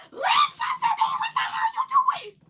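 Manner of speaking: high, loud outraged yell; imitated shriek. Sister yelling as money gets grabbed, high shriek.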